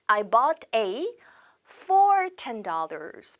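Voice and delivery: every word drawn out for learners; slow model sentence. Shown as a demonstration. Teacher reading an example to students, very slow and clear. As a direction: read it very slowly to the class. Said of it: "그러면 어떻게 쓰면 돼요?".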